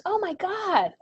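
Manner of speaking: high, loud mock scream; retold scream. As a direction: imitate a child's horrified outburst. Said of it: "she screams".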